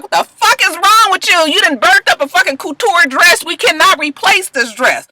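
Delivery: high, loud furious snapping; high angry mock rant; angry diva rant. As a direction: tear into her over the dress. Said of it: "Tiana is like".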